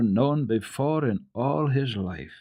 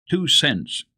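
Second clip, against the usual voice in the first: put-on firm, a little louder boy's answer; decided; 6 semitones higher, 5 dB louder than usual. A narrator's reading. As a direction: state it with mind made up. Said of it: "replied Peter decidedly".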